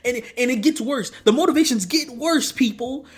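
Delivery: high, loud exasperation; exasperated complaint. Real, not acted. Vlogger warning viewers the motivations get worse, loud high exasperation. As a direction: raise the voice, appealing to the audience.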